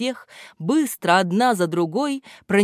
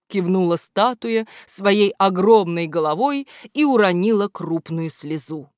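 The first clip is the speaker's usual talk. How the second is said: ordinary delivery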